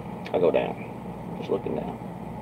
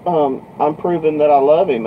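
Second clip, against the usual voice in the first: higher-pitched justification; imitated earnest girl; 6 semitones higher, 3 dB louder than usual. Girl justifying herself to trucker, lightly imitated earnestness. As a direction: lightly mimic a girl defending her love.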